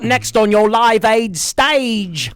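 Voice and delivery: slow, high stage announcer. Pretend. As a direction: announce the next act to the crowd. Mock Live Aid announcer.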